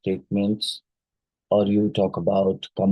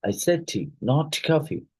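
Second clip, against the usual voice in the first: falling "tea", then "not coffee" jumping higher; contrastive pitch shift; 6 semitones higher than usual. Shown as a demonstration. Teacher highlighting one part to stress the difference, contrastive pitch shift. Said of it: "A shift in pitch highlights one part".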